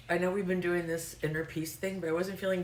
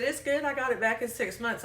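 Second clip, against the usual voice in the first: high, imitated Arkansas caller; heavy Arkansas accent; 8 semitones higher than usual. Caller reassuring the agent about her license, Arkansas accent. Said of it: "just really heavy accent for me".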